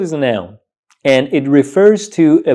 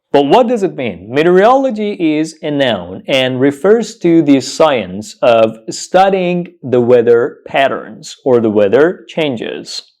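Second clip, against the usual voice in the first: normal talking voice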